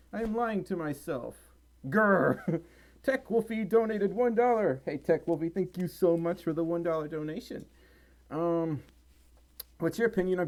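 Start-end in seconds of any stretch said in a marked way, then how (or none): none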